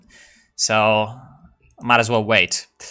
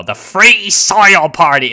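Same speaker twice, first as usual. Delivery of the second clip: 1 proclaiming; 7 semitones higher, 8 dB louder, slower than usual. Real, not acted. Proclaiming the party name.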